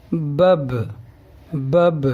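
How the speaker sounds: بب read with the a vowel, bab, clearly and twice; sounding out bab. Shown as a demonstration. Teacher shows how بب sounds with zabar. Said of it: "تو اس طرح pronunciation ہوگا".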